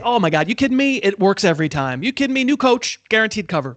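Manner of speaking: high-pitched, incredulous excitement; hammed-up excited disbelief; mock excited bettor. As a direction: pitch it up like a giddy bettor sold on a sure thing. Mimics an excited bettor's reasoning.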